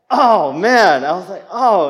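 loud, high "oh man" of being caught out; mock stung exclamation; stung surprise. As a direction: gasp it out like an arrow hit.

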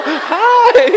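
very high, loud mock hello; silly greeting. Man hiding under a wig, silly high greeting. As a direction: chirp a disguised hi way up high.

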